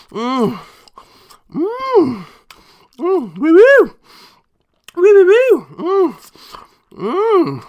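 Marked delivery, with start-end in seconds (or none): 1.5-2.3 high appreciative moan; savoring moan. Real, not acted. Savoring a bite of meat. 3.4-5.7 loud high hooting of delight; excited hooting. Real, not acted. Savoring a bite of meat.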